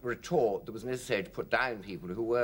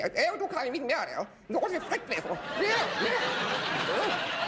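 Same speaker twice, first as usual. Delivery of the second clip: mock posh hostess, pitched much higher, laughing through it; awful middle-class voice. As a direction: send up a frightfully genteel lady. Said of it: "The middle class thing, that awful frightful sort of".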